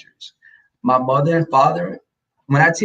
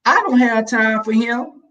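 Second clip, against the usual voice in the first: high-pitched mama brush-off; imitated sassy dismissal; dismissive mother; 9 semitones higher than usual. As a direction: wave him away in a high voice. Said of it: "my mother would be like".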